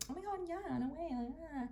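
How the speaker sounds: put-on high, soft valley-girl gush; girly girl talk. Shown as a demonstration. Vlogger showing how she talks as a girly girl, high and gushing. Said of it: "I really talk like this sometimes".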